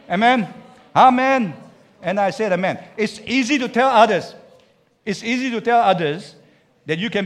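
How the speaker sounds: loud, high call to the congregation; fiery preacher call-and-response; preaching at full force. Real, not acted. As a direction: rouse the room. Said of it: "preaching at full force".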